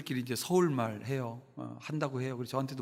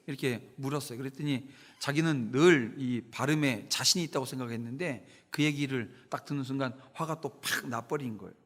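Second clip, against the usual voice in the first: usual speaking voice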